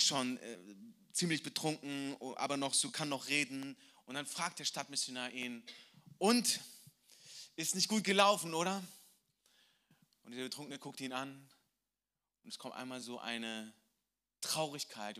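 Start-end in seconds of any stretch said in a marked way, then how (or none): 6.2-8.8 put-on higher, louder, probing question to a drunk; missionary's question. Missionary asks the hungover drunk, raised voice. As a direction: ask him straight, raised voice.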